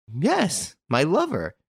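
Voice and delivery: high, proud admission. A narrator's reading. Girl admitting to Renine she has a lover, proud. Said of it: "she said proudly".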